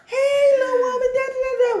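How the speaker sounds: laughing, squeaky mock pickup line; mock flirty greeting. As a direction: ham up a sweet-talking hello with a laugh.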